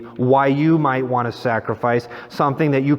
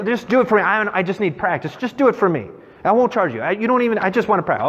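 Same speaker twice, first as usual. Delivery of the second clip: higher-pitched, insistent coaxing; wheedling street artist; 6 semitones higher, faster than usual. Retold or imitated. Paris artist begging a tourist to sit, wheedling. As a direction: imitate a hawker pleading for a sitter. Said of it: "they're trying to get me".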